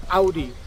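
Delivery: "Audi" as ow-dee; "Audi" opening like the cry ow; German pronunciation. Shown as a demonstration. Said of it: "Think like, "Ow, I hurt myself".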